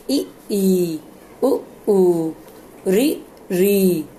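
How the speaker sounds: each vowel drawn out slowly; long vowels. Shown as a demonstration. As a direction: stretch ई, उ, री to two beats each. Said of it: "जिन स्वरों के उच्चारण में दो मात्रा का समय लगता है".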